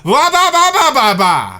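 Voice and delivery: put-on loud, high babbling toddler; imitating a baby's shouted babbling; baby babble. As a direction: blurt baby babble at full volume. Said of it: "and he'll be like".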